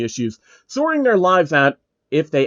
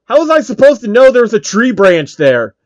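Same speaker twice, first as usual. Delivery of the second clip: indignant yell, higher and louder; exasperated shout, louder and higher; angry outburst; 8 semitones higher, 8 dB louder than usual. Acted. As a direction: blow up at the unfair tree branch. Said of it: "It was the sound of anger".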